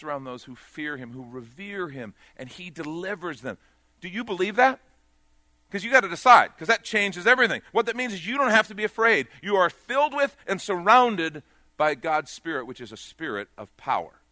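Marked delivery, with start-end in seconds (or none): 4.0-12.7 loud, rising preacher's call, higher; preaching at full force. Acted. Preacher urging the congregation, preaching at full force. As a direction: drive the point home to the whole church.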